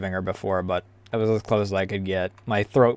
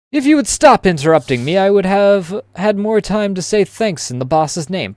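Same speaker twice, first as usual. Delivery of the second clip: annoyed character voice; 8 semitones higher, 5 dB louder than usual. Acted. Character snaps at interruptions.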